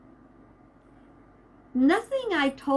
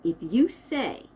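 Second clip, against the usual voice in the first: ordinary talk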